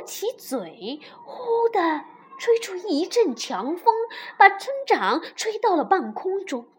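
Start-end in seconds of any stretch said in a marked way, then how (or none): none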